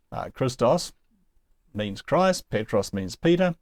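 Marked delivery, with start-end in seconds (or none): none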